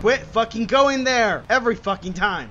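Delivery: loud, exasperated yelling; angry complaint. Real, not acted. Player misses the same way repeatedly, exasperated yell. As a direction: vent real frustration at full voice. Same misthrow every time.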